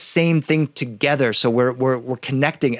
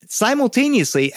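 "Simultaneously" pitched high with a falling end; playful high emphasis on "Simultaneously"; repeated story word; 9 semitones higher than usual. Shown as a demonstration. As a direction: sing out "Simultaneously" for young listeners. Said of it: "I use that word ten more times in the story".

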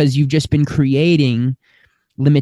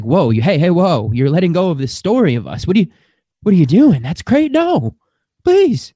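desperate ego; 6 semitones higher than usual. Retold or imitated. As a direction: voice the clinging ego rising into panic. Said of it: "And it's desperate".

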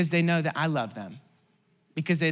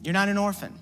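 higher, louder preaching over music; 6 semitones higher, 4 dB louder than usual. Acted. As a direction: lift the room with each line.

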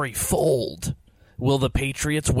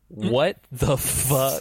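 ordinary talk

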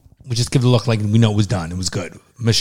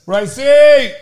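high, loud drawn-out cheer; excited greeting shout; 12 semitones higher, 6 dB louder than usual. Real, not acted. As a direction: holler the name in welcome. Calling out a greeting to Bryce.

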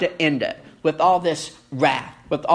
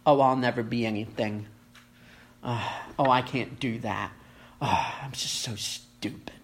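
self-pitying whine; 6 semitones lower, 6 dB quieter than usual. Acted. Mock self-pitying heart voiced.

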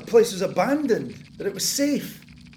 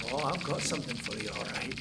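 menacing mutter; 7 semitones lower, 8 dB quieter, faster than usual. Acted. Menacing aside to rats.

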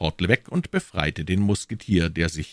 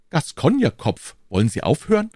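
king's sharp raised call, high; exasperated royal outburst, higher and louder; 10 semitones higher, 3 dB louder than usual. A narrator's reading. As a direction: cry out at him in a higher voice. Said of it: "rief der König".

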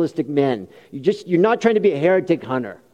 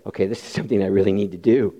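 low, quicker voice of someone realizing; lightly voiced inner resolve, pitched down; convicted listener; 8 semitones lower, faster than usual.

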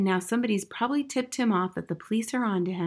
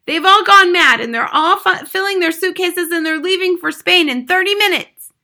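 put-on high, loud frantic child; crying out; 10 semitones higher, 12 dB louder than usual. A narrator's reading. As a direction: blurt the news out in panic. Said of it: "she cried".

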